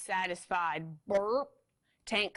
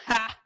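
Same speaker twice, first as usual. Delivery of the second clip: mean laugh; 3 semitones higher, 8 dB louder than usual. Acted. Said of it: "cranked Tank".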